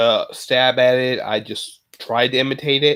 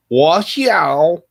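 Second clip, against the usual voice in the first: loud, higher attempt at a Chinese accent; 5 semitones higher, 6 dB louder than usual. Shown as a demonstration. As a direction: have a rough go at a Chinese accent. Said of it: "do a Chinese accent".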